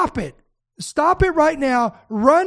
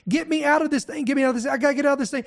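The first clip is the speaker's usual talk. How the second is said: ordinary delivery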